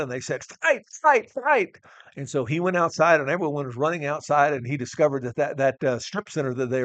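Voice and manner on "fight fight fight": put-on teenage crowd egging on a brawl, shouting high; yelling. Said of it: "he heard some people yelling".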